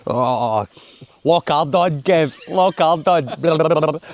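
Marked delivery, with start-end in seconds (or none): 1.2-4.0 milk the pun slowly; drawn-out joke. Pretend. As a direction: stretch it out slowly, teasing.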